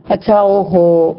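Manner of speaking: slow, drawn-out weary groan; reluctant sigh. Retold or imitated. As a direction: drag out the groan, fed up. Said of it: "ہم کہتے ہیں". (بیزاری سے لمبی آہ)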